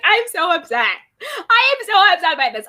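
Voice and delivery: high, loud, hammed-up fake crying; mock tearful upset. Host mimicking castmate crying without tears, mock tearful upset. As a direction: imitate a tearless wail of being so upset. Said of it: "I love the fake tears".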